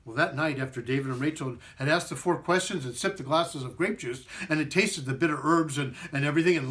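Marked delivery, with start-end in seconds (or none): none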